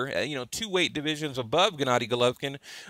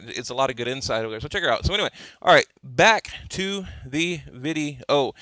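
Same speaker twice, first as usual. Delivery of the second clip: ordinary talk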